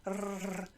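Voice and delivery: a sustained trilled "rrr"; long Italian-style trill on "rrr"; rolled R. Shown as a demonstration. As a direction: roll the R in "rrr" on the tongue. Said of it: "if you can roll your R's".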